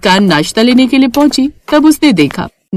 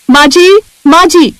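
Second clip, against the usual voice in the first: higher and louder, summoning mother-in-law; calling out; 7 semitones higher, 5 dB louder than usual. Acted. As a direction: shout for mother-in-law across the house. (सास को ऊँची आवाज़ में पुकारती जेठानी)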